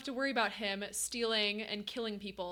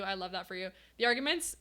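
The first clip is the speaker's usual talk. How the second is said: usual speaking voice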